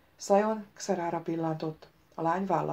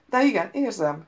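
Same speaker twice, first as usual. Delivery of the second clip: raised, emphatic answer; loud reply; 3 semitones higher, 8 dB louder than usual. A narrator's reading. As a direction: answer out loud for all to hear. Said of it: "szólt a herceg hangosan". (hangosan, határozottan)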